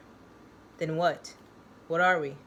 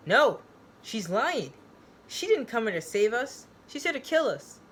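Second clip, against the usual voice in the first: shouted, higher and louder accusation; accusing shout; 5 semitones higher, 5 dB louder than usual. Acted. Joe shouting to the group that she lied, accusing shout. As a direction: burst out, warning everyone.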